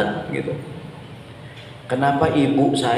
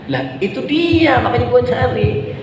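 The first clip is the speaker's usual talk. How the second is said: exasperated husband; 8 semitones higher than usual. Retold or imitated. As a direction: throw up your hands at the wife's question. Husband retorts to wife. (suami gemas, nada jauh lebih tinggi dan lambat)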